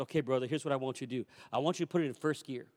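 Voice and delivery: quick quiet orders to the driver; urgent low instructions. Retold or imitated. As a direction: pastor to bus driver, low and fast.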